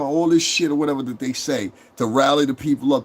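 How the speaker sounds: usual speaking voice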